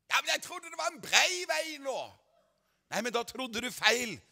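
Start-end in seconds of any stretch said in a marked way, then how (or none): 0.1-2.2 laughing mock voice of a doubter; mocking objector. Preacher mocking a church member's excuse, laughing. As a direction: play the naive listener, amused.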